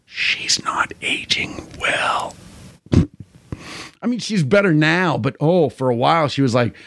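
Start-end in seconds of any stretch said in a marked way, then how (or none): 0.0-2.3 soft unvoiced whisper; whispered aside. Real, not acted. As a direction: whisper it behind your hand. Whispered aside about actress.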